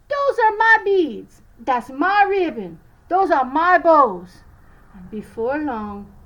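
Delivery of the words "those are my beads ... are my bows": loud, high, slow angry sister; screaming. A narrator's reading. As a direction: shriek over stolen beads.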